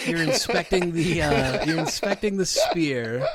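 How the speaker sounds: laughing. Real, not acted. GM laughs restating the action.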